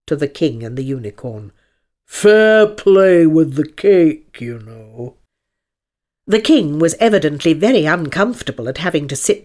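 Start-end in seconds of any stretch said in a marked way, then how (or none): 2.0-5.2 slow, loud drawl sinking away at the end; ponderous, sleepy lion voice; weary lion. Acted. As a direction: warn lazily, then let the voice drop.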